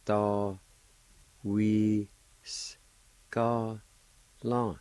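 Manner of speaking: Da, we, s, ka, la each set apart; teacher breaking word for learners; separated syllables. Shown as a demonstration. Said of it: "it's pronounced like this".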